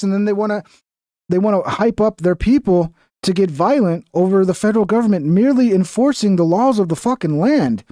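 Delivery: ordinary talk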